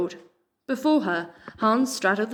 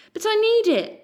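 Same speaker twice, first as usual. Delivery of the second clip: high, louder, pleading; desperate; 5 dB louder than usual. A narrator's reading. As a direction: beg desperately to keep it. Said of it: "Pip said desperately".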